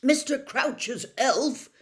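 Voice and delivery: high, loud, slow disbelief; thunderstruck. A narrator's reading. Percy stunned at the elf news, thunderstruck. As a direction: say it like the ground just fell away. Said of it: "sounding thunderstruck".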